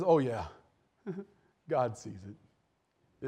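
low, slowing reply with a chuckle; knowing retort. Retold or imitated. Prophet answering people who sin in the dark, knowing.

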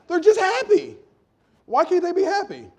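high, loud protest; exasperated shout. Real, not acted. Preacher defending happy kids to parents, exasperated and loud. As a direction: cry out in defence of them. Repeats defence, much louder.

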